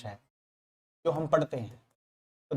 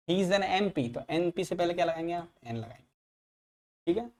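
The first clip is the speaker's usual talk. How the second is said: ordinary talk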